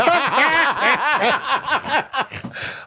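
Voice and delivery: high burst of laughter; big laugh. Real, not acted. Reacting to the Aussie accent request.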